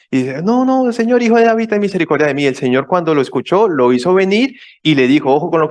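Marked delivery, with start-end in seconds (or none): 0.4-2.4 retold cry, high and insistent. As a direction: shout over the crowd for mercy. Blind man cries out again.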